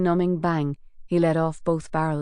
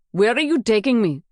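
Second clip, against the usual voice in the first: demanding; 4 semitones higher, 4 dB louder than usual. Acted. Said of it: "Garda Wasan demanded".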